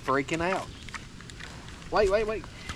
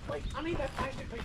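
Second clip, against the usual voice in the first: softer, fast coaxing; hushed and quick; 7 semitones higher, 10 dB quieter, faster than usual. Real, not acted. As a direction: quickly coax it under your breath. Pleading with pig for plastic.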